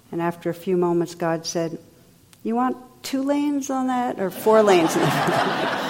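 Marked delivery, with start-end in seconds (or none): 2.4-5.8 laughing. Acted. Punchline of the joke.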